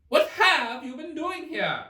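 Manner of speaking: high haughty queen voice; the queen complains grandly; imperious queen. A narrator's reading. Queen scolds gardeners, high haughty voice.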